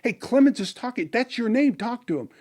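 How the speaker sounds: urgent nudge. Retold or imitated. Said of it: "elbowed me and said".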